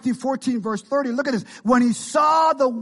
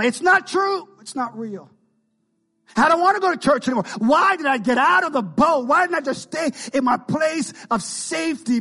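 higher, protesting mock outburst; acted outburst; 5 semitones higher than usual. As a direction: cry out in bitter protest as the girl. Girl's angry loss of faith acted.

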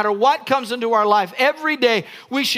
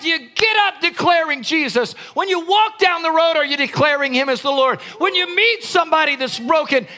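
higher, shouting pulpit challenge; fervent preaching; 6 semitones higher than usual. Acted. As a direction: build to a cry on the last words. Preaching at full force.

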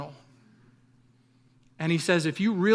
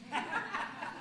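laugh; 13 semitones higher, 14 dB quieter than usual. Real, not acted. Laughs after mentor's slap.

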